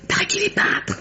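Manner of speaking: breathy gossip whisper, high; hushed confiding whisper. Acted. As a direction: lean in and whisper the secret. Gossiping about Trent to the staff.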